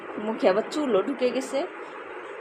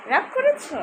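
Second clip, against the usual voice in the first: put-on same question lifted much higher, babyish; much higher cooing; 12 semitones higher than usual. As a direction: pitch it way up like cooing to a baby. Asks sulking child twice.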